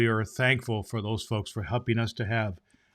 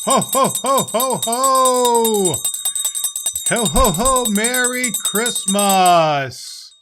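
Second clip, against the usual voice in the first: loud, high booming Santa laugh; belly-laugh ho ho ho like Santa; jolly ho ho ho; 7 semitones higher, 6 dB louder, slower than usual. Acted. Santa's big entrance laugh.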